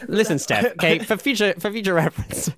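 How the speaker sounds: high, emphatic mock telling-off; playfully exasperated, voice jumping high; mock exasperation. Mock scolding a listener over names.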